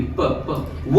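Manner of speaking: soft airy प from the lips; प puffed out, no throat; voiceless p. Shown as a demonstration. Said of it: "यहाँ से मुंह से नहीं".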